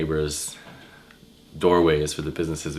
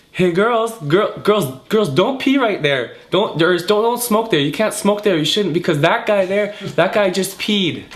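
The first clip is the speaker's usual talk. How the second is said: much higher, louder calling out; 14 semitones higher, 4 dB louder than usual. Retold or imitated. Bouncer calling out to girls by the door, high and loud. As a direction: call across the doorway to the girls.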